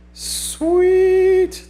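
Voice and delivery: high, loud drawn-out sweet; excited sweet. Real, not acted. Streamer thrilled that Ashton got a permanent job, high and loud. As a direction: stretch the word out in delight.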